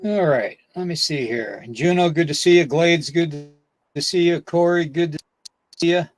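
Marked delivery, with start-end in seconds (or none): none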